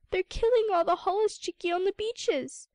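little seal pleading with the sea lion; distressed pup cry. Acted. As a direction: wail for help from the water. Pup reports slaughter, distressed.

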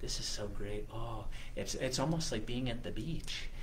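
soft, low inner voice; thinking to himself. Acted. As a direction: murmur a happy thought privately, very softly. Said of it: "he actually thought to himself".

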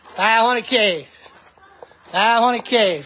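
drawn out slowly like a yard-sale barker; slow sales call. Real, not acted. Calling out to buyers for the Harley, slow and drawn out. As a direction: stretch each word to reel in a buyer.

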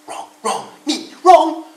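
very loud, high building yell; shout. Acted. Rabbit shouting back at Bear, very loud and high. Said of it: "shouted Rabbit".

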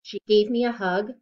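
steady, bright storybook read-aloud; reading to children. Acted. Reader sharing a picture book with kids, rhythmic read-aloud. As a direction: read each rhyme to a young listener.

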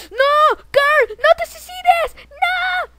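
high, loud drawn-out screams; play-acted desperate shout; horrified yelling. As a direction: cry out to stop a companion. Horror as Carl endangers himself.

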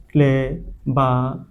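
Cleverest slowly broken into pieces, pauses between; syllable by syllable. Shown as a demonstration. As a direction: sound out cleverest, ending set apart. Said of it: "EST যোগ করে কি করেছি". (Cleverest থেমে থেমে ধীরে ভেঙে বলা)

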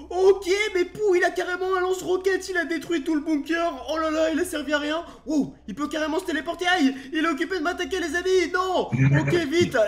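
high, loud urgent alarm; rattled and rushing; panicked. Real, not acted. Fleeing attacking monster.

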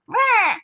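high, short noisy outburst; imitated whir. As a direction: mimic your reaction to fan noise. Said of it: "That's what I do".

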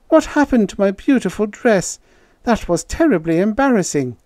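high-pitched, put-out female character; complaining woman. A narrator's reading. Irene complaining to Peter about her dress, put out. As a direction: voice an embarrassed woman, pitched well up.

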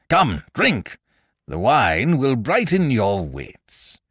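high, booming royal order; commanding king. A narrator's reading. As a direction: urge the drink on him, grandly drawn out. King orders jester to drink.

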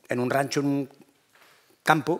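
ordinary delivery

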